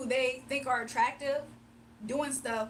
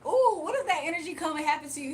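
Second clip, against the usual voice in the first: usual speaking voice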